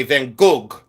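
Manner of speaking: Gogh said slowly as "goog", hard g at the end; other people's pronunciation. Retold or imitated. Seller quoting how others say it, Gogh as "goag". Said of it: "they say Van Gogh".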